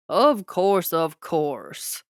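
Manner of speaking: loud then dismissive Texas drawl; Texas twang. A narrator's reading. The Texas president brushes off the prince, dismissive twang. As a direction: wave it away, folksy.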